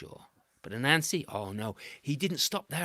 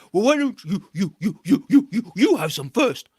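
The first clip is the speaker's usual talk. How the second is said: louder stuttering monkey voice; wary stammer; 6 semitones higher, 11 dB louder than usual. A narrator's reading. Monkey stalling Anansi, loud and stuttering.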